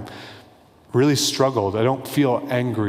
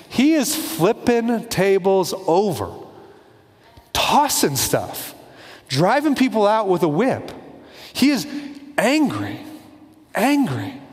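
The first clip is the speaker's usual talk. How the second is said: higher and punchy, slow on the flipping; forceful emphasis; 6 semitones higher, slower than usual. Real, not acted. Preacher telling the congregation Jesus is angry, forceful emphasis. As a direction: hammer home the anger word by word.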